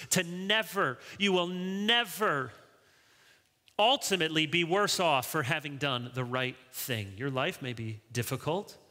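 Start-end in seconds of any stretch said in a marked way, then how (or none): none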